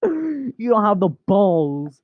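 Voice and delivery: high, drawn-out mock challenge; theatrical taunt. Owner taunts the retreating cat, declaiming theatrically. Repeats dare as quotation.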